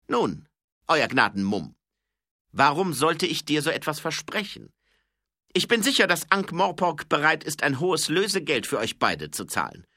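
loud, high-pitched villain; selbstsicherer Räuber. Acted. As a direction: taunt the captive with booming confidence. (lauter, höhnisch selbstsicherer Räuber)

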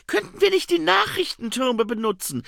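high, loud, eager question; Saccharissa. Acted. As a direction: pitch it up as the keen young woman. Figure asks eagerly in story. (hohe, laute, eifrige Frage)

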